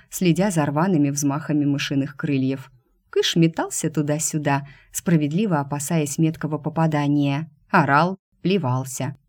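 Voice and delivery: usual speaking voice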